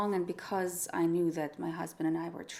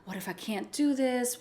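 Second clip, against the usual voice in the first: ordinary delivery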